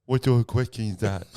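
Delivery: put-on deaf man's speech; imitated deaf voice. Audience member answers the comic, imitated deaf voice. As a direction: mimic a deaf man answering. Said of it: "fully doing the voice".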